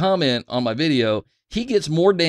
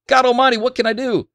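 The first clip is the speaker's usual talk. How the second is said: mock exasperation; 11 semitones higher, 5 dB louder than usual. Real, not acted. Exasperated at viewer's demand.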